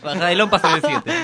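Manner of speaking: laughing. Real, not acted. Repeats joke laughing.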